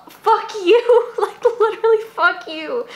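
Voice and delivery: high-pitched, tearful fury; angry outburst. Real, not acted. Host cursing someone profiting from loss, upset and furious. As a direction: lash out through real tears.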